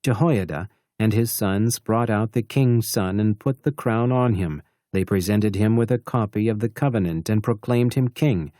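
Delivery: ordinary talk, nothing marked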